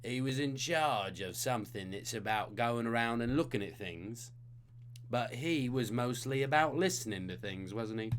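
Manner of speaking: a lightly put-on Cockney character; playful Cockney hint voice; host giving the trivia hint in a mild Cockney; Cockney accent. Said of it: "weird Cockney character hint of the night".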